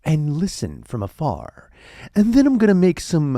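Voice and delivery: deliberate, rising celebrity scientist impression; Neil deGrasse Tyson. Scientist plans to observe from afar, lofty impression. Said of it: "how do you like this impression?".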